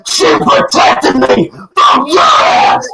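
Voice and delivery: real screaming meltdown, voice breaking. Real, not acted. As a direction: lose it completely, yelling through tears.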